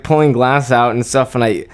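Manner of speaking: usual speaking voice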